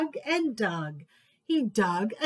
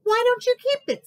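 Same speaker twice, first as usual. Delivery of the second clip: much higher, louder and fast; eager mole; 13 semitones higher, 6 dB louder, faster than usual. Acted. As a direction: offer it quickly and brightly. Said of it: "said Mole".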